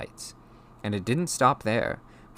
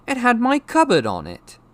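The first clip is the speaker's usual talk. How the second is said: put-on high, loud boy's argument; angry protest; 10 dB louder than usual. A narrator's reading. Boy proving the letter was his, loud angry protest. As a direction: argue back hotly. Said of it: "said Harry angrily".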